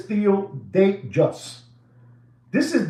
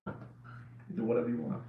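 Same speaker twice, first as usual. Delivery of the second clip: breathy whispered aside, much softer; 12 semitones lower, 14 dB quieter than usual. Acted. Repeats the line, then whispers it.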